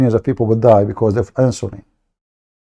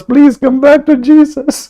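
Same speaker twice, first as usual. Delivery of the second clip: much higher, louder whiny pleading; hammed-up begging, pitched way up; mocking plea; 13 semitones higher, 5 dB louder than usual. Streamer mimics Muslims begging Christians back to Jesus, mock whine. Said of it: "You ask us".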